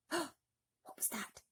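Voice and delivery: hushed, slow alarmed whisper; frightened whisper. Acted. James startled by a distant voice, whispering. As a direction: breathe it out in alarm. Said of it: "he asked quickly".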